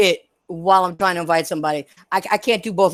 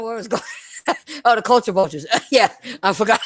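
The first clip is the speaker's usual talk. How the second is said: ordinary delivery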